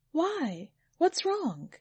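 high-pitched, puzzled demand; insistent questioning. A narrator's reading. Master pressing his silent servant, high and insistent. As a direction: pitch leaps up, then drops on each question.

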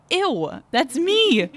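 squealed, exaggerated disgust; retold disgust. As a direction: act out being grossed out, pitch jumping way up. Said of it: "I was disgusted by her".

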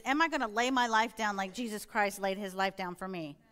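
ordinary talk, nothing marked

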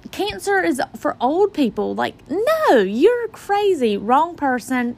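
high, rising disbelief, slowing on crazy; incredulous denial. Retold or imitated. To the doctor, high incredulous denial. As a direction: brush off the diagnosis as absurd. Denying the diagnosis.

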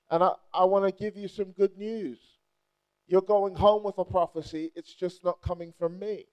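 normal talking voice

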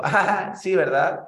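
high, sarcastic "sí, ¿verdad?"; retold mocking doubt; mocking disbelief. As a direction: scoff at the dream, lightly sarcastic. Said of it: "la persona se rió y dijo".

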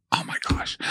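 breathless hushed gasp of wonder; a hushed little "oh my gosh"; awed whisper. Retold or imitated. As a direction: whisper it like an amazed kid. Kid amazed watching mom's trick.

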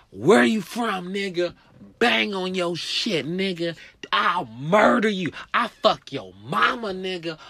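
high, loud, slow threatening rant; gangbanger tirade. Retold or imitated. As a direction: bark threats at the scared kid.

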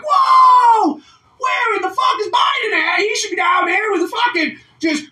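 very high, loud hammed-up indignant shouting; mock outraged yelling. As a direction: overdo a furious demand for answers. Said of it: "They're like".

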